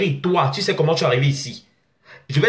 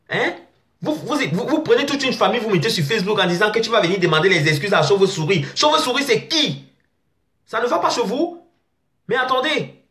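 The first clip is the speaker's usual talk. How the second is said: much higher pitched indignant rant; outraged, voice climbing high; 8 semitones higher than usual. Real, not acted. Outraged rant at fake profiles. (indignation aiguë, voix très haute)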